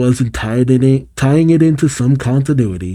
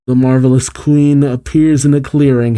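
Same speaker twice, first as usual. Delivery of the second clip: usual speaking voice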